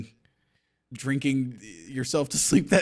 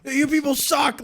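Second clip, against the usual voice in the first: mock scream; 12 semitones higher, 4 dB louder than usual. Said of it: "screaming at the audience".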